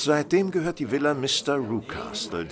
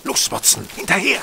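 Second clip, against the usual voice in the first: urgent command; 7 semitones higher, 5 dB louder than usual. Acted. Urging Watson to chase.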